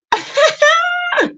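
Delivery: high-pitched burst of laughter; laughing. Real, not acted. Delighted by chat comments.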